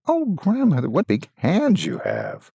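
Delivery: put-on wondering girl. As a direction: marvel at each strange feature. Girl questions disguised wolf.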